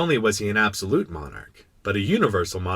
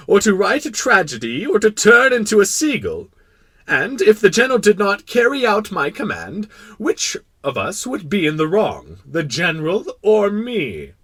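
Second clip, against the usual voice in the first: loud, high, declaiming monarch; pompous reasoning king; 9 semitones higher, 8 dB louder than usual. Acted. King testing the prince with a question, pompous. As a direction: build a grand royal argument.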